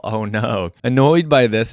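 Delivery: ordinary delivery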